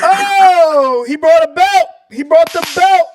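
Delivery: loud, high, laughing disbelief; excited outcry. Real, not acted. Co-host reveals a belt, loud excited reaction. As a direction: whoop at a hilarious surprise.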